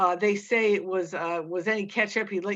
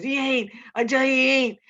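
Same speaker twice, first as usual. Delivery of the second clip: high, loud despairing cry; desperate denial; 7 semitones higher, 8 dB louder than usual. Acted. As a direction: cry it out, hands over his ears. Hands over ears, denying.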